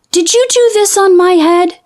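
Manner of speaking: high, earnest little mole; little mole asking. A narrator's reading. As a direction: ask it like a small puzzled mole. Mole asks the pig.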